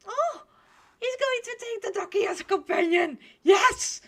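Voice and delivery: high, loud squeal of joy; delighted outburst. Real, not acted. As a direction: cheer excitedly for the duck. Delighted the duck comes along.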